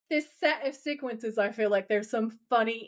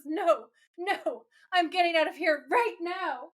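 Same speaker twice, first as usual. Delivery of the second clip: hammed-up whimpering, pitched higher; mock crying panic; 7 semitones higher than usual. Brody bails on Wendy, mock tearful higher voice. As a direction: blubber it like a terrible actor fleeing. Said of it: "And Brody says".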